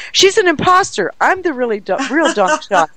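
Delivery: high excited imitated yelling; mock yell. Friend running in, yelling she's the real one. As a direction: squeal it like the imposter caught out. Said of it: "she's yelling".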